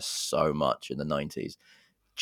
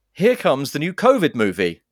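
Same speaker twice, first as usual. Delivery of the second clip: high, loud trailer hype; hammed-up blockbuster introduction; mock film announcer; 7 semitones higher, 8 dB louder than usual. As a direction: announce it grandly, pausing for effect.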